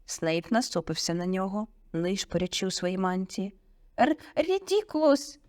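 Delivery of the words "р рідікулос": high, stammered squeaky spell; squeak. A narrator's reading. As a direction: squeak the incantation out, stumbling on the start. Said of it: "пискнув Невіл".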